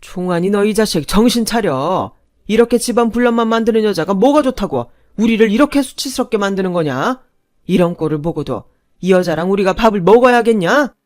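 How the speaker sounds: loud, raging father yelling at his son; enraged father shouting at his son in the restaurant; roaring father. Acted. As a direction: bellow at him to come to his senses. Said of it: "버럭 소리를 지르며".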